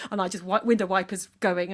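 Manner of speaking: ordinary delivery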